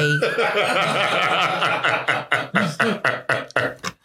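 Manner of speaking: drawn-out, helpless run of laughter; long laugh. Real, not acted. Guest about to name her pastime, cracks up at length. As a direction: break down laughing before you can answer.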